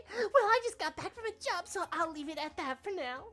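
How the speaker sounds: put-on high bubbly anime girl; cute anime girl voice. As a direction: chirp it out brightly. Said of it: "Your anime girl voice is good".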